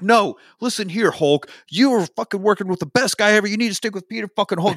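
high, heated telling-off; mock yelling. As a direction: act out an indignant rant. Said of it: "Starts yelling at Tevin".

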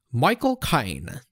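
slow, drawn-out Cockney accent impression; Michael Caine. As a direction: say it slowly like a London film star. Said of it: "say in the Michael Caine accent".

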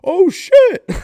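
blurted oh shit. Retold or imitated. Cousin Brian blurting at the store clerk, a loud high yelp. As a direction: blurt it out with zero subtlety. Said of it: "with zero subtlety whatsoever".

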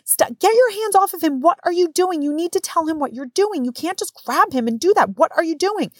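high, outraged mom's protest; retold yelling. Mom yelling at staff handling her son, high outrage. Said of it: "I start yelling".

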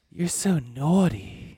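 slow, drawn-out sultry put-on voice; Jennifer Coolidge impression. Friends doing Jennifer Coolidge in a slow sultry voice. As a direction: purr it slowly like a vamp.

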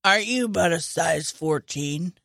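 low, creepy killer voice; creepy Buffalo Bill voice. Retold or imitated. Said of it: "and this is how he talks".

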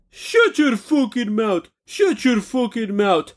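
slow, drawn-out angry put-down; angry rant. Real, not acted. Ranting at a company's slogan, drawn-out angry dismissal. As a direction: stretch each word out with irritation.

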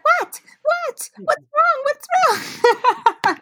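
very high, alarmed motherly voice; high-pitched frantic what's wrong; worried mom. Retold or imitated. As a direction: imitate mom panicking over her crying daughter. Mom alarmed at crying daughter.

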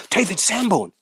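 high, eager excited questions; excited quote. Retold or imitated. Excited client voiced in anecdote.

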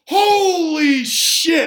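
high, long-drawn shocked curse; stunned exclamation. Retold or imitated. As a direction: stretch it out in acted disbelief. Reacting to smoke from the battery.

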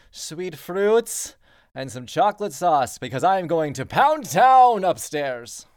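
loud, high, slow royal bellow; pompous prince on intercom. Acted. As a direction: announce yourself grandly like a spoiled prince. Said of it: "an intercom message comes through".

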